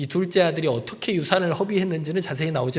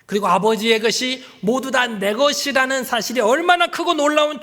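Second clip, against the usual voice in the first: preaching climax; 6 semitones higher, 3 dB louder than usual. Real, not acted.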